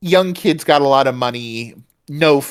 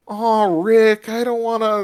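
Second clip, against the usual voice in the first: put-on high, whiny nervous teen; whiny Morty; 10 semitones higher than usual. [name] imitated as Morty whining to Rick, high and whiny. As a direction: whine it up like a scared sidekick. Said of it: "that same whiny Mortyism".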